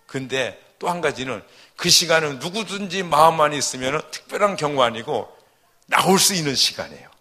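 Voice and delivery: loud, driving sermon voice; forceful preaching. Acted. A pastor loudly urging the congregation to come pray. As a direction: press the congregation hard, loud. Urges prayer attendance.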